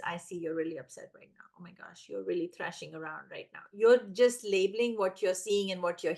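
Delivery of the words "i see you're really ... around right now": calm self-talk. Shown as a demonstration. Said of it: "the sentences you're gonna use in your brain".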